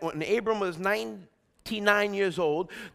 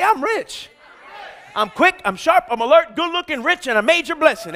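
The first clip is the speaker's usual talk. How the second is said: high, loud, punchy declaration; rousing, high-pitched call-out; bold confession; 6 semitones higher, 6 dB louder than usual. Real, not acted. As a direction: fire off each claim for the crowd to repeat. Leads congregation in bold confession.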